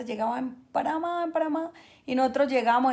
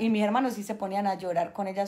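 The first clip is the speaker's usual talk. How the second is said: ordinary delivery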